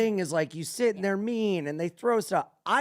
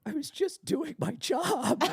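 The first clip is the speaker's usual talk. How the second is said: imitated meek voice, higher pitched; out of character, meek; 6 semitones higher, 3 dB quieter than usual. Insulting waiter dropping character to explain himself, meekly. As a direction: drop the tough act and plead, mock-hurt. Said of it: "He dropped character and goes".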